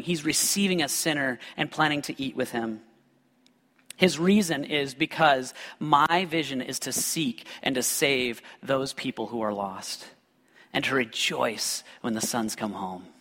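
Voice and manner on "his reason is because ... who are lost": ordinary talk, nothing marked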